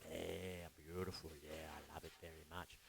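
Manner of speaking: soft self-talk. Retold or imitated. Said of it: "and just go".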